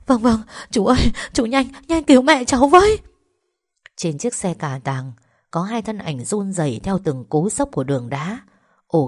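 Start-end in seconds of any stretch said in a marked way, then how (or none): 0.1-3.0 high, loud frantic urging; desperate daughter's plea; panicked pleading. Acted. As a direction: beg him to hurry, rising to a cry. Daughter begs rescue of mother.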